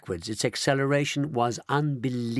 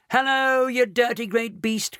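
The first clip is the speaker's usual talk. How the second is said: cried out; 10 semitones higher than usual. A narrator's reading. Mr Wonka jeering at the canid outside, mocking cry. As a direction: jeer at the bruised beast. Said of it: "cried Mister Wonka".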